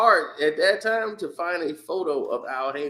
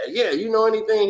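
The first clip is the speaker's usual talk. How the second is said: eager reporter; 9 semitones higher, 4 dB louder, faster than usual. Retold or imitated. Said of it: "He said".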